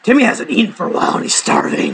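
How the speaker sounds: low spooky warning; ominous tease. Pretend. Teasing girlfriend about the hungry weasel in a low voice.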